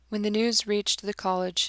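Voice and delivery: usual speaking voice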